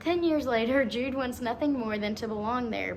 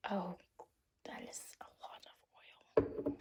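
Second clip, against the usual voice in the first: hushed, unvoiced aside; surprised whisper; 3 semitones lower, 10 dB quieter than usual. Real, not acted. Reacting to the oil in the pan, in a whisper. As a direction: breathe it out in disbelief.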